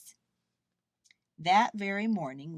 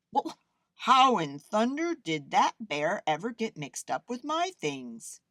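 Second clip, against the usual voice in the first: louder, lifted puzzled artist; puzzled wondering; 4 semitones higher, 6 dB louder than usual. A narrator's reading. Artist finds a bear in his laundry, wondering. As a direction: wonder aloud in an emphatic artist's voice. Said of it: "the artist wondered".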